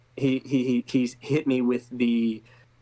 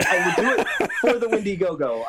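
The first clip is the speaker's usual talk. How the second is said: laughing; 8 semitones higher, 3 dB louder than usual. Real, not acted. Guest agrees while laughing.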